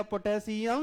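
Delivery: Potassium with the final "यम" held extra long, rising; mock a future reader dragging out "यम" in potassium; exaggerated stretched ending. Shown as a demonstration. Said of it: "वो और लमरा देगा". (Potassium का "यम" खूब लंबा खींचकर)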